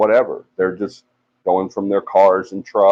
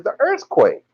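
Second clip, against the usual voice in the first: insistent; 13 semitones higher, slower than usual. Retold or imitated. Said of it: "And I said".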